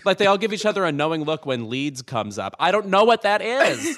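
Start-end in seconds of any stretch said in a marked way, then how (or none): 2.6-4.0 baffled. Real, not acted. Baffled by British quiz clues.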